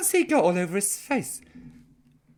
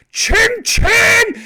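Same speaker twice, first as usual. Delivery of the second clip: toast shout; 13 semitones higher, 10 dB louder than usual. Real, not acted. Toasting Debbie Palmer over cider, a high loud cheer.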